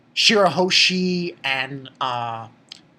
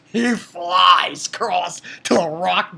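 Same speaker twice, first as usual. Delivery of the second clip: loud, slow, stretched-out shout; hyped retelling at raised volume; excited storytelling; 5 dB louder, slower than usual. Real, not acted. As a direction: stretch the flight out in a big shout. Excitedly narrates a huge kick.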